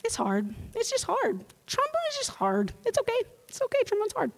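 high, soothing mock teacher voice; condescending teacher. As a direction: pat the kid on the head with your voice. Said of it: "your teacher looks at you and says".

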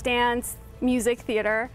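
usual speaking voice

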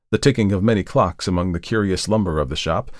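usual speaking voice

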